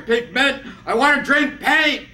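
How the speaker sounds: loud, high poetic shout; climactic shout. Acted. Shouted climax of performed poem.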